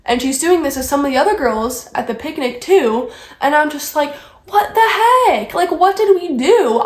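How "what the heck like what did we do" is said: high, swooping indignant protest; exasperated outburst. Retold or imitated. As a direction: burst out, baffled and fed up. Exasperated retold reaction to snub.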